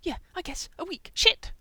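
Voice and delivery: high voice jumping to a loud curse; sudden outburst. A narrator's reading. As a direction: start offhand, then explode on the last word. Manic hamster has sudden realisation.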